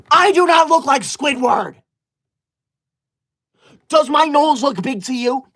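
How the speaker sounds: shouted denial at a teasing viewer; indignant shout. Acted.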